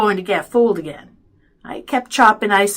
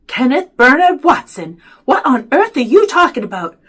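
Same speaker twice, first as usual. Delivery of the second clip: louder, higher reprimand; stern scolding; 4 semitones higher, 8 dB louder than usual. A narrator's reading. Mother questions frantic son, stern and exasperated. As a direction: demand an explanation using the full name.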